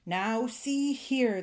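slow, emphatic reprimand; stern rebuke. A narrator's reading. Fairy fed up with the old man, sternly. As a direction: stretch each word as a warning.

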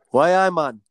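put-on Newcastle accent; broad mock Geordie catchphrase. Joking that his girlfriend is a Spanish Geordie, mock Geordie accent. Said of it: "she's like a Spanish Geordie".

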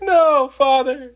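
put-on high, slow pleading kid voice; mock-dramatic plea from a son; Damian pleading. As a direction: cry out to stop his father. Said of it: "He's like".

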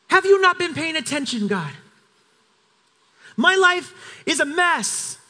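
a prophet at the end of his rope, pleading; exasperated plea. Acted. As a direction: throw the question at God in frustration. Elijah's plea paraphrased.

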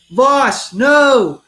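loud warning yell at a friend; shouting a warning. Retold or imitated. Warning Voss before the windshield, a loud retold yell. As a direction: call out to stop him. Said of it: "And I was like".

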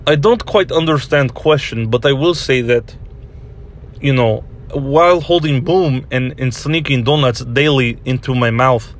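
put-on Russian crew member's accent. Russian boom operator explains set thefts to interviewer, in a Russian accent. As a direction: answer as a Russian with imperfect English. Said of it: "do this Russian accent you do".

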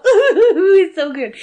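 high, loud mock boo-hoo; hammed-up blubbering with laughter; happy cry. Showing co-host what happy crying is, happy cry. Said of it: "Happy cry is great".

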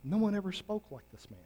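a quiet marvelling report; awed officers. Retold or imitated. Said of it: "the officers simply answered this".

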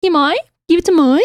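high, exaggerated suburban Aussie whine; Kath and Kim accent. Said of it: "watched too much Kath and Kim".

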